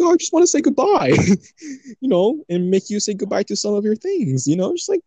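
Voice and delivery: high, sing-song fake innocence, hammed up; mock sweet goodbye. Joking to friends about robbing her house, mock sugary voice.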